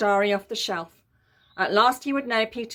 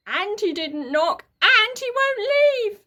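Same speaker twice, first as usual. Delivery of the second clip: put-on whiny tattling child; 11 semitones higher, 6 dB louder, slower than usual. Peter tattling to Mum.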